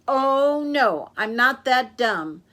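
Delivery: loud, drawn-out scornful refusal; knowing refusal. Acted. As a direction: show you won't fall for it. Girl refuses the bait again.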